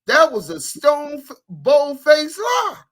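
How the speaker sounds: high, loud indignant outburst; heated accusation. Real, not acted. Calling out a man for lying, heated accusation.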